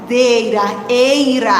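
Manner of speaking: "deira", then "eira", each set apart and stretched; isolated word ending. Shown as a demonstration. Teacher shows children the rhyming ending, sounded out. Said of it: "Se eu parar bem pra pensar".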